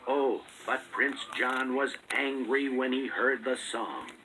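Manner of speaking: ordinary delivery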